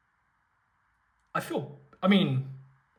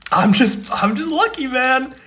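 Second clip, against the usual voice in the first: overjoyed; 7 semitones higher, 6 dB louder than usual. Real, not acted. Overjoyed at luck.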